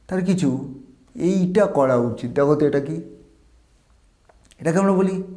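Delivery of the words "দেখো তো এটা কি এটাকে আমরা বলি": ordinary talk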